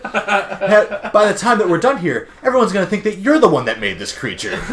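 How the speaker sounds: gloating laugh. Retold or imitated. Lex gloating at Clark, laughing as he speaks. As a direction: gloat through a laugh like a villain.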